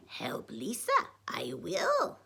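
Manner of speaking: whispery croaking puppet sage; Yoda. A narrator's reading. Yoda promising to help Lisa, whispery croak. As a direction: croak it in a breath, little voice. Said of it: "said Yoda".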